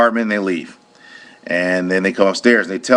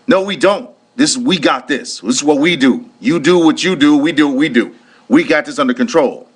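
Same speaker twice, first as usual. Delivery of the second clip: a mimicked brusque cop, higher; imitating dismissive sergeant; 7 semitones higher than usual. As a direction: brush him off like a sergeant who outranks him. Sergeant dismissing security guard.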